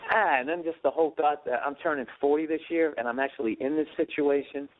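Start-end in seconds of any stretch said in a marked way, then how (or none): none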